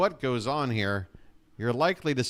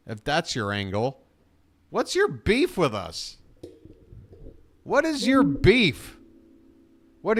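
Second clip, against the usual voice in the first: heated, pitch rising, hammering every question; angry rant; 5 semitones higher than usual. Real, not acted. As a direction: vent at the league like a furious fan. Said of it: "I did a rant".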